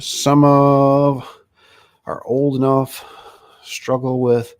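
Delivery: squinting at the screen, stopping word by word; halting read. Real, not acted. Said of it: "what does it say here?".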